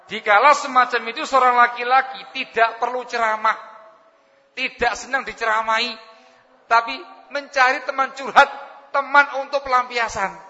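loud, high pulpit delivery; preaching at full force. Acted. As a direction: hammer the point to the congregation. Preacher raises voice on point.

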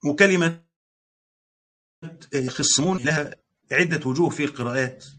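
normal talking voice

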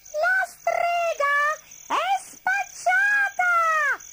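piercingly high, slow, loud bat cry; shrieking bats. A narrator's reading. Bats telling the runaways about the witch, shrieking. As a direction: shout it out high, stretching every word.

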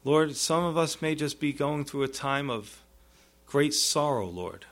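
earnest pastoral prayer, repeating Lord; closing prayer. Acted. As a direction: pray over the congregation gently.

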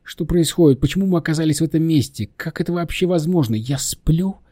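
high-pitched, confused questioning; bewildered. Acted. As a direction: ask in rising bewilderment. Girl bewildered on waking up.

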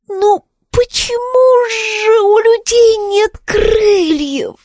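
high, slow, near crying; lament. Acted. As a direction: wail it, close to tears. Wizzix laments no wings. (жалобный плач)

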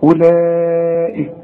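أولئك with the madd drawn out to four counts; slow Quranic recitation of أولئك; long vowel stretch. Shown as a demonstration. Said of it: "لازم تمد هذه الكلمة أو هذا المد".

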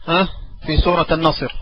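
ordinary talk

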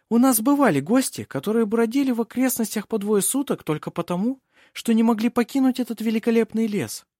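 high, airy aristocratic lady; lofty hostess holding the floor; commanding princess. Acted. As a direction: smoothly brush off concern in a high voice. Princess cuts Yves off.

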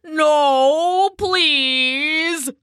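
high, drawn-out bullfrog plea; frightened pleading. A narrator's reading. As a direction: beg for mercy in fear. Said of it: "the bullfrog whimpered".

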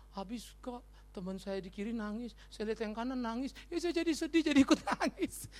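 put-on small, whiny child's answer climbing in pitch; imitated weepy child. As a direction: mimic a child explaining tearfully, rising to a mock wail. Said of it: "Kamu tadi kenapa nangis?".

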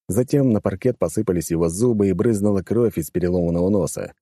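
usual speaking voice

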